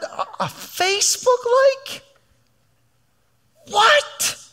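high, mocking disbelief, climbing to a squeak; God scoffing at a Facebook like; rising amazed what; God incredulous. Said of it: "I feel like God would say".

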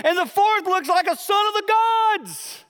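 high, loud, awestruck king; stunned king spotting the fourth man; mock awe; awed king. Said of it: "And he goes".

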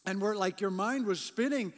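ordinary talk, nothing marked

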